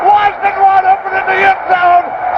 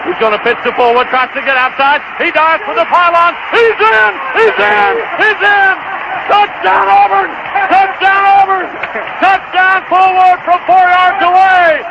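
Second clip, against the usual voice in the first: touchdown call. Real, not acted. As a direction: scream the winning score. Game-winning touchdown call.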